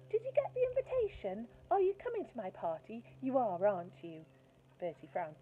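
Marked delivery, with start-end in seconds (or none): 0.1-4.2 put-on a sweet girl calling to her crush; sugary little girl. Angela pressing about her party.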